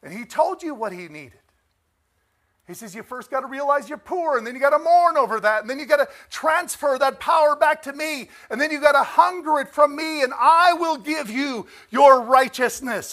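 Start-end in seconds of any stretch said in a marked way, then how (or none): 3.0-13.1 loud, higher preacher's cry voicing Christ; full-force preaching. Preacher proclaiming Christ's words to the church, full-force preaching. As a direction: preach it out to the congregation, rising.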